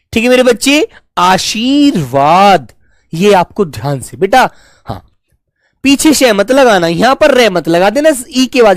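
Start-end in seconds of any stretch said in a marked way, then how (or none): 1.2-2.7 आशीर्वाद drawn out slowly, the र barely touched; slow आशीर्वाद, short र sound; careful pronunciation. Shown as a demonstration. Teacher repeating आशीर्वाद slowly for the children. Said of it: "थोड़े से समय के लिए बोला जा रहा है".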